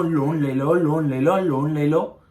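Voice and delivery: mimicked, insistent sales chant; pestering loan caller. Imitating the bank's constant loan calls, mock pestering. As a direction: repeat the pitch like a nagging telecaller. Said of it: "દિવસમાં સત્તાવીસ વાર phone કરો છો".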